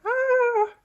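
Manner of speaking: sudden high shout of fright; frightened yell. Acted. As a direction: yell out like a scared cartoon face. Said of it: "Now he's yelling".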